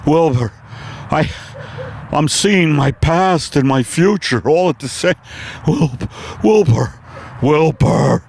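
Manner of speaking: a dazed horse struggling to breathe; Mister Ed on acid. Acted. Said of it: "my impersonation of Mister Ed".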